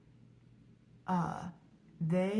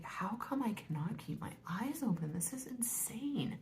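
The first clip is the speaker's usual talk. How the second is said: disbelieving whisper; 5 semitones higher, 7 dB quieter than usual. Retold or imitated. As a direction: hush it out, stunned and drained. Retold disbelief, eyes closing.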